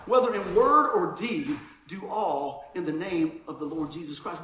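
scripture reading. Acted. Reads scripture aloud.

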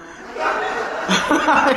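loud, high belly laugh; real laughter, rising and loud; hearty laugh. Real, not acted. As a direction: crack up at your own joke. Laughing at his own joke.

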